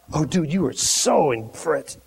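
usual speaking voice

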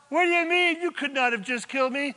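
high, loud, fast retort; shouting from afar. Acted. Saul answers David from afar, indignant shout. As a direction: holler back quickly across the distance. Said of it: "from some distance away".

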